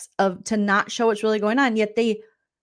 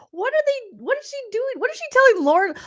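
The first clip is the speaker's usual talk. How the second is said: much higher, baffled mock panic; high bewildered squeak; 12 semitones higher than usual. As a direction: pitch up high in confused alarm. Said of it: "I was like".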